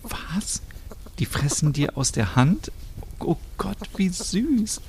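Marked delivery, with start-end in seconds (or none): 0.0-0.6 breathless whisper; flüsternd; very quietly. Acted. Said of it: "Stina ganz leise". 3.2-4.8 hushed delight. Acted. Stina delighted by squirrel, hushed.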